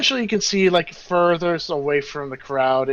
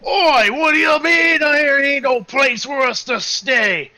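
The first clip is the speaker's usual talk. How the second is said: loud, high outraged shout with rough-and-ready "Oi"; Jacques; 9 semitones higher, 6 dB louder than usual. Acted. Jacques protests being refused a place to stay, loud and indignant. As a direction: bellow the complaint at full volume, pitched high. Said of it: "the loud voice of Jacques going".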